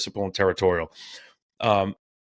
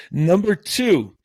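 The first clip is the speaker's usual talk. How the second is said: laughing; 10 semitones higher, 3 dB louder, much slower than usual. Real, not acted. Laughing after finding the page.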